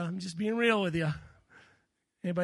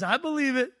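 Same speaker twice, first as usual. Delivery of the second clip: louder, higher confident declaration; Jacob's confident reply; 5 semitones higher, 6 dB louder than usual. Retold or imitated. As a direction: answer boldly with faith. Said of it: "And Jacob goes".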